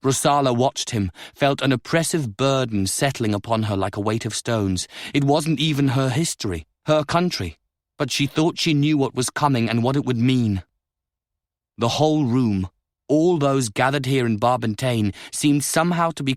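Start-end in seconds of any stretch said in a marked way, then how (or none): none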